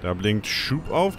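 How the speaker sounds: usual speaking voice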